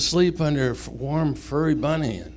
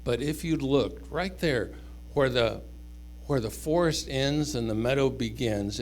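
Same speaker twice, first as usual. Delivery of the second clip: ordinary delivery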